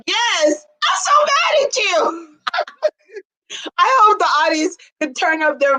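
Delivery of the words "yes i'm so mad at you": imitating an overwhelmed fiancée, high-pitched; mock upset yes. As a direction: mimic her worked-up outburst in a much higher pitch. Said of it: "first engagement where someone's like".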